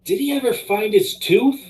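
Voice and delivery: retold yell. Stranger yells across the street at dog walker, high-pitched mock yell. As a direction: call out high, like a stranger yelling over. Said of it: "he yells at me".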